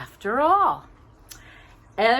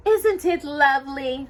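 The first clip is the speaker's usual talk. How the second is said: delighted; 8 semitones higher, 5 dB louder than usual. Acted. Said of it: "Cinderella said loud".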